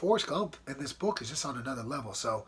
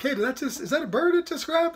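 much higher and louder, retelling his shock; astonished outburst; 13 semitones higher, 9 dB louder than usual. Retold or imitated. Calling to two ladies after a hawk strikes, stunned high outcry. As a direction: blurt it out like you can't believe your eyes. Seeing hawk grab a bird.

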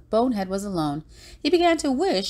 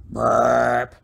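loud, low mock belch; deep storybook belch; low burp; 7 semitones lower, 4 dB louder than usual. A narrator's reading. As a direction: burp the word like a full monster. Voicing the monster's burp.

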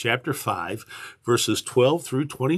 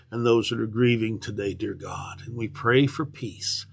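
prayer; 3 semitones lower than usual. Acted. Pastor leads opening prayer.

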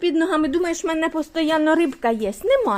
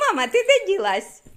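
voicing the dog; 7 semitones higher than usual. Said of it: "Да, каже".

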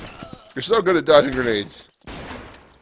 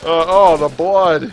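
excited; 4 semitones higher, 7 dB louder than usual. Real, not acted. Excited at gore.

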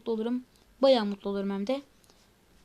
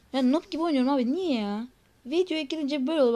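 louder, higher frustrated gamer rant; getting angry; 3 semitones higher, 6 dB louder than usual. Real, not acted. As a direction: vent the annoyance at your own bad play. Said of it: "Sinirleniyorum abi".

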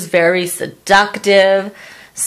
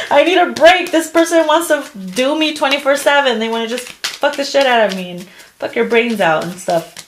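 exhausted laughing; 6 semitones higher than usual. Retold or imitated. Reading tarot to a viewer, a high, laughing mock complaint. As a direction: laugh it out, worn out but thrilled. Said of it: "And you're like".

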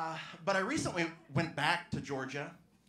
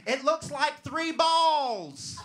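slow, much higher and louder mock yell; heckler yell; 12 semitones higher, 9 dB louder, much slower than usual. Heckler yelling from the crowd, slow loud mock shout. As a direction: bellow it like a drunk heckler. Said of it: "Someone always yells out".

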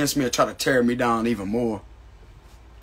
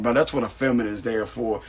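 normal talking voice

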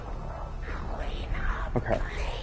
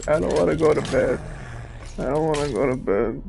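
put-on higher, whiny kid refusing bedtime; playful bratty child, higher pitched; mock child whine; 5 semitones higher than usual. Answering robot's bedtime scolding.

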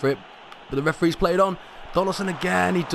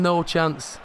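ordinary talk

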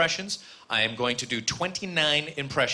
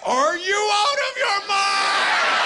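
very high, loud, drawn-out shriek; hammed-up high-pitched scolding yell; wife yelling; 4 dB louder, slower than usual. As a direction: scream it in mock outrage as the angry wife. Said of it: "why you're yelling at me".

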